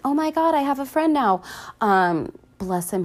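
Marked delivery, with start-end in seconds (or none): 0.1-1.4 high, thrilled little robot squeal; a lightly voiced BB-8, much higher and overjoyed; excited droid. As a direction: gush like a delighted droid. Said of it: "B B eight's like".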